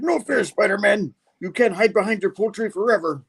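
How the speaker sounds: high, hammed-up villain whine; whiny mock villain. Doc Ock losing to a goose, high whiny complaint. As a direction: complain up high like a sore loser.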